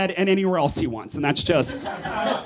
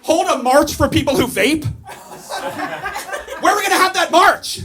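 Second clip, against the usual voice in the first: mock rally rant; 7 semitones higher than usual.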